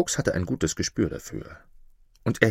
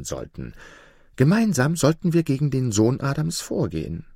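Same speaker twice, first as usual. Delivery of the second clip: higher, meek fox voice; humble fox; 6 semitones higher than usual. A narrator's reading. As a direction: flatter the master like a humble fox. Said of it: "Mit demütiger Stimme begann der Fuchs". (demütiger Fuchs, hoch und unterwürfig)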